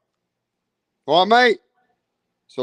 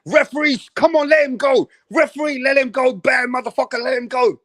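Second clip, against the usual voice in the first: mock corner yell; 6 semitones higher than usual. Dubois camp yelling at referee.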